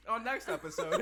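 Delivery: teaser line through giggles; laughing. Real, not acted. Cracking up with her co-host, laughing teaser. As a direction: squeeze it out while laughing.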